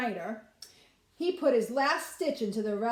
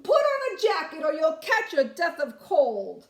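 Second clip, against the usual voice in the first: loud, higher grandma calling after him; fussing bubby shout; 6 semitones higher, 7 dB louder than usual. Acted. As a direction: nag him while running. Bubby shouting advice while chasing.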